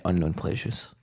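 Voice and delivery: normal talking voice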